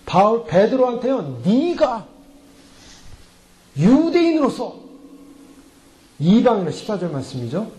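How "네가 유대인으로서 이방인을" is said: slow, emphatic reproach with raised pitch; drawn-out, accusing delivery; acted rebuke. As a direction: imitate a stern reprimand, phrase by phrase. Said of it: "바울이 책망하는데 어떻게 책망하냐면".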